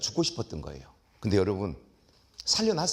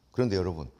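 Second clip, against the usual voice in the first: ordinary talk, nothing marked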